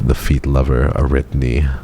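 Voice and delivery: normal talking voice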